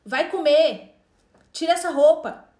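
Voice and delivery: mandando alto, firme, como mãe dando ordem; mãe impaciente ordenando ao filho; ordens em voz alta. Retold or imitated. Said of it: "a gente acaba falando assim". (ordens de mãe em voz alta)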